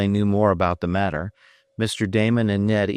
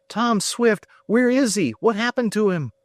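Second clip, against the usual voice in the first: high, urgent questions in rising alarm; Ned blurts out his shock at the news; alarmed cry. A narrator's reading. Ned learns Tom is missing, crying out in alarm. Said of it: "cried Ned".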